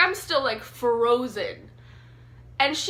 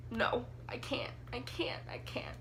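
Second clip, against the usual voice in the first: panicked whisper; 12 dB quieter than usual. Retold or imitated. Reliving panic after the incident.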